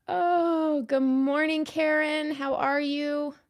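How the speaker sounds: light up at a familiar name; excited greeting. Real, not acted.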